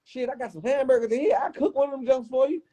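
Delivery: lively retelling of his own offer; high imitated voice. Retelling offering to cook for Craig.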